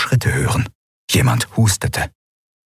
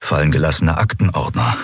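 ordinary delivery, nothing marked

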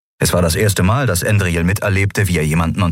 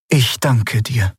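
slow, gentle gratitude; tender thanks; 5 semitones higher, slower than usual. Acted. (sanfter, langsamer Dank)

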